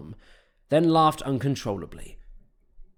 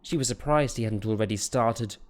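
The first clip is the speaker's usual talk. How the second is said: ordinary delivery, nothing marked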